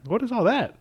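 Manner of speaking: high, puzzled "what is all that"; puzzled question. Retold or imitated. Friend puzzling over the bin, high and baffled. Retold surprised question at brisket.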